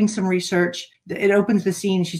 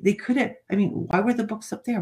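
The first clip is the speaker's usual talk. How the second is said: ordinary talk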